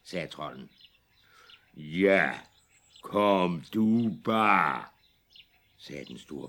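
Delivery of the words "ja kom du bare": slow, loud taunt; defiant big goat. Acted. Big goat dares the troll to come, slow and loud. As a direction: dare the troll, unafraid.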